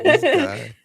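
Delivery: high, loud laughing mock of her; mocking his partner. The host mimics his partner teasing him, high and laughing. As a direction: squeal it like she teases him. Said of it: "She go like".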